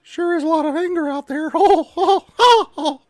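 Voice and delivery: high, loud squeaky cartoon mouse laughing; hammed-up cartoon mouse cackle; Mickey Mouse. Streamer doing Mickey Mouse for chat, high and loud.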